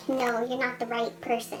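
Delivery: a hint of a curt office lady; imitating clerk.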